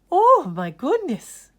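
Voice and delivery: loud and high, dismayed; surprised exclamation. Acted. Art teacher reacts to Ned's silence, surprised. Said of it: "she said".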